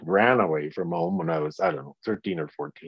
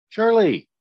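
mock answer; 14 semitones higher, 7 dB louder than usual. Mock answer to own question.

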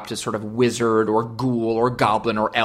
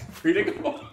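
said through laughter; laughing; 4 semitones higher, 5 dB quieter than usual. Real, not acted. Laughing at the gunman bit, free to go. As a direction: giggle the words out. Laughing while repeating joke.

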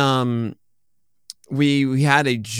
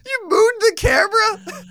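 high disbelieving squeal, much higher; shocked shriek; 4 dB louder than usual. Real, not acted.

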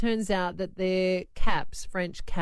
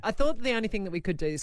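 usual speaking voice

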